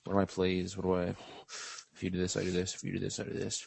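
intense muttering. Retold or imitated. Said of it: "you can't be, like, intense".

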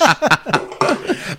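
high burst of laughter; real laugh. Real, not acted. Friend jokes about eucalyptus-flavored soda, amused high laugh. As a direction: crack up at a friend's joke. Amused by the Moxie medicine joke.

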